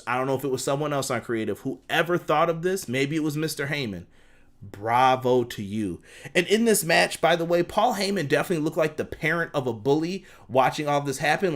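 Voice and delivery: ordinary delivery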